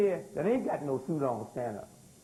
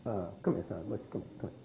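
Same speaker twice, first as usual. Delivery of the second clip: hushed call; 12 semitones lower, 8 dB quieter, faster than usual. Acted. Calling a boy over quietly.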